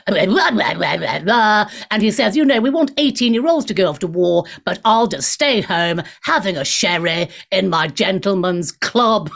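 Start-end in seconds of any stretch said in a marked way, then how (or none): 0.1-1.7 a hint of a plummy general mumbling blah blah; lightly mock the old general's drone; mocking Sir Patrick. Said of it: "who talks like this". 2.3-9.3 lightly voiced plummy old general; a touch of the stuffy officer sneering at the young; mocking Sir Patrick. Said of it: "who talks like this".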